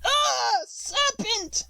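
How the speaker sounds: very high pigeon shriek. A narrator's reading. Pigeon shrieks at Alice, very high. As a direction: rise to a frantic shriek. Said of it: "raising its voice to a shriek".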